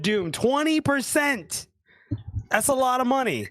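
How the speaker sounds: peppy pitch with stretched key phrases; sponsor ad read. Real, not acted. Caster plugging Rogue Energy discount code, upbeat ad read.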